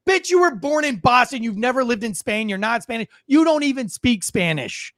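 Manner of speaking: usual speaking voice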